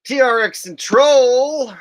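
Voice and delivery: high, loud, slow announcer call; drawn-out hype shout of a donor name; hyped name call. Acted. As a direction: boom the name out like a ring announcer. Reading out a super chat name.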